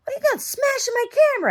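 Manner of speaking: high, loud, fast outraged yell; screamed at. Retold or imitated. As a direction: shriek the dad's outrage about the camera. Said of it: "starts, like, screaming at me".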